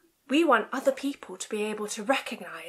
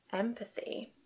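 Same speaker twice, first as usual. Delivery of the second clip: whispered word; 10 dB quieter than usual. Shown as a demonstration. Librarian telling toddlers a secret word, whispered. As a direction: whisper Empathy softly like a secret. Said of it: "Empathy whisper".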